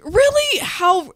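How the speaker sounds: a sharp high "Really?" in disbelief; indignant retort to a rude stranger; incredulous. Retold or imitated. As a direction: squeak out mock outrage at her.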